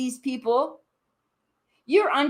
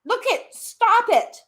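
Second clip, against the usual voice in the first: much higher, cutting her off; sharp angry cut-off; 10 semitones higher, 3 dB louder than usual. Real, not acted. Cutting off the lash seller mid-argument, sharp and angry. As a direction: snap it out to stop her talking.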